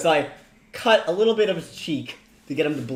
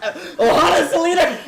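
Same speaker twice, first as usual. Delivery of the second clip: laughing exclamation; 7 dB louder than usual. Real, not acted. Joke, laughing loudly.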